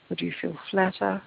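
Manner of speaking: "flatter" in a Kiwi accent, the a pushed toward e; New Zealand accent. Real, not acted. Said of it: "I just said flatter like a New Zealander".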